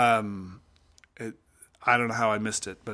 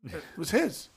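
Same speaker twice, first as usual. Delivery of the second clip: calm quiet father; 8 dB quieter than usual. Retold or imitated. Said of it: "And Dad's like".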